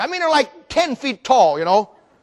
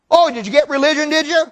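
higher, mock-curious jeer; mocking taunt; 5 semitones higher than usual. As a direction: needle them for getting religion. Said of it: "they make fun of you".

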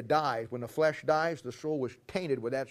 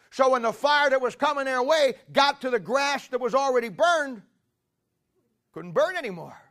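climbing into full-force preaching, higher and louder; rising preaching; 6 semitones higher, 5 dB louder than usual. Acted. Preacher building to the congregation, climbing in force. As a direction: build the sermon to a high, loud peak.

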